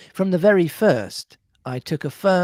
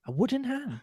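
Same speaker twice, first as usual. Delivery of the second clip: puzzled; 10 semitones higher, 7 dB quieter than usual. Real, not acted. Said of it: "Sorry, a wooden hand".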